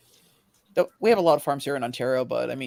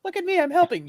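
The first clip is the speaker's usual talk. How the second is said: mock proud; 12 semitones higher than usual. Mocking a lazy helper.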